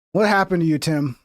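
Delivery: ordinary talk, nothing marked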